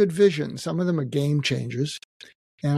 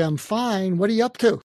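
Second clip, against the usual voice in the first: bright, high brush-off of the question; the usual chipper deflection; breezy brush-off; 7 semitones higher than usual. Retold or imitated. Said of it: "And I would usually say".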